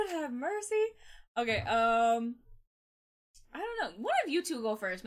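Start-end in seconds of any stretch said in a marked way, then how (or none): none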